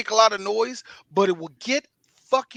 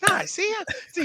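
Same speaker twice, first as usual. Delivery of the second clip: high-pitched actor impression; Mark Wahlberg; 11 semitones higher, 4 dB quieter than usual. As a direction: brush it off in a raised put-on voice. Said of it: "Mark, you're out of breath".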